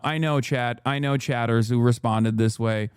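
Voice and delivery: ordinary talk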